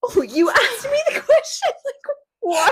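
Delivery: Johnny baffled at the lawyer, mock high and laughing. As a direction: play it incredulous, half laughing. Johnny's baffled reaction retold.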